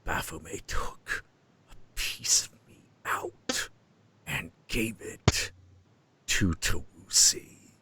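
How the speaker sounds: whispering demon, drawn out; demon's voice. Retold or imitated. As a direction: whisper it haltingly, with long eerie breaks. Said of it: "And he's like".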